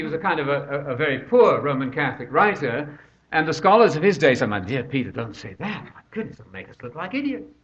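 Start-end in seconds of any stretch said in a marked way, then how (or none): 4.5-7.5 soft, confidential plea to a colleague; hushed mock admonition. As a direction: quietly scold a friend, play-acted. Scholars voiced pleading with Peter Lombard.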